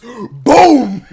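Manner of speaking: drawn-out, loud, high boom; booming blast imitation. Hunter firing at a baby deer, loud explosive boom.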